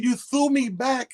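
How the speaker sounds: high, slow disbelieving echo; incredulous retort. Retold or imitated. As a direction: stretch it out in disbelief.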